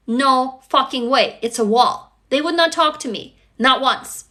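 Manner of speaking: usual speaking voice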